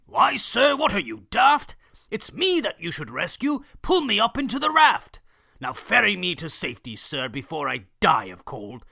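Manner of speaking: high, loud outraged bluster; indignant demand. Acted. Circus boss demanding the captain rescue him first. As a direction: boss barking to be saved first.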